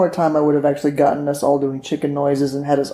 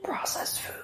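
whispered answer; whispering; 14 dB quieter, slower than usual. Real, not acted. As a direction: breathe the words, no voice.

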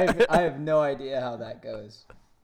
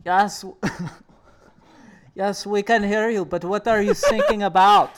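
put-on loud, higher-pitched comic German accent; 7 semitones higher, 10 dB louder than usual. German ship replies to a mayday in a loud comic German accent. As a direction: answer as a hammed-up German radio operator. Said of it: "And then a German ship responds".

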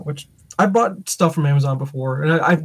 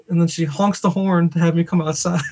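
normal talking voice

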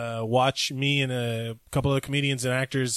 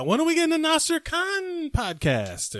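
high mock whine; 14 semitones higher than usual. Teasing demand in high voice.

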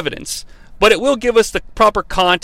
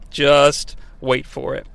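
slow tease; 3 semitones lower, 3 dB quieter, slower than usual. Real, not acted. Repeats teaser slowly.

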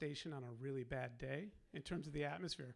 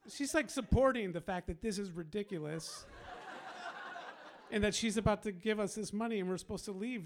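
louder, higher, laughing through the words; amused, louder disbelief, laughing; laughing while talking; 3 semitones higher, 5 dB louder than usual. Real, not acted. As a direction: tell it half laughing in disbelief. Laughing at ridiculous situation.

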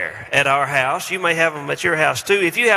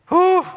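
whoosh of relief; 5 dB louder than usual. Real, not acted. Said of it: "exhale after the imitation".

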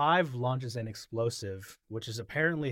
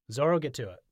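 louder, higher mock order; retold command; 4 semitones higher, 7 dB louder, much faster than usual. Nami orders Zoro to get to it, commanding. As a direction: toss out a brisk, imitated command.

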